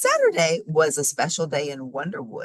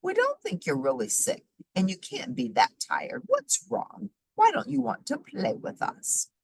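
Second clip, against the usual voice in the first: squirrel voice; 11 semitones higher than usual. A narrator's reading. Squirrel character voice.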